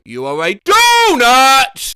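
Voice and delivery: loud, slow, stretched-out shout; mock shout. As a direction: belt out the word donut. Reading autocomplete, shouted.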